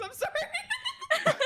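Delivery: high giggling apology; laughing. Real, not acted. Laughing fit.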